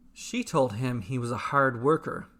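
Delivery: ordinary delivery, nothing marked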